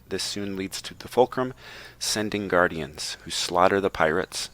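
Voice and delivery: ordinary talk, nothing marked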